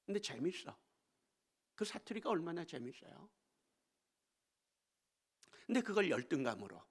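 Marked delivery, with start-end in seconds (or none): none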